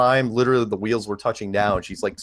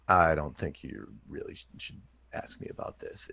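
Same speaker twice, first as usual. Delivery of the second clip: dropping to a near whisper to tease; secretive hush; 6 semitones lower, 9 dB quieter than usual. Retold or imitated. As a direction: lower the voice as if sharing a secret. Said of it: "I'm into reverse psychology".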